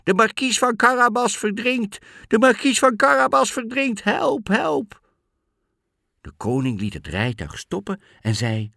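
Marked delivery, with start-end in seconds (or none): 0.0-5.0 very high, loud cry for help; shouting for help. A narrator's reading. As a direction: scream for rescue as the alarmed cat. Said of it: "schreeuwde hij". (luid en hoog om hulp schreeuwen)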